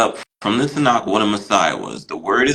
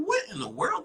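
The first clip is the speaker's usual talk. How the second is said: much higher, squeaky burst of shock that drops away softer; high-pitched retold gasp of disbelief; stunned disbelief; 11 dB quieter than usual. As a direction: retell the jaw-drop moment in a high squeak. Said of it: "I was like".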